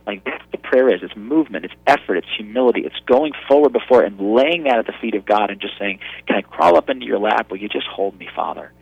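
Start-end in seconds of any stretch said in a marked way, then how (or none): 6.3-8.7 tender plea. Retold or imitated. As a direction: ask it tenderly, almost breaking.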